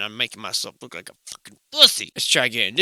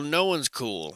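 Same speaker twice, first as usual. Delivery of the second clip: normal talking voice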